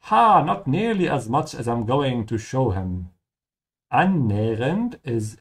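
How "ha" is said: mock triumphant laugh. Acting the character's laugh.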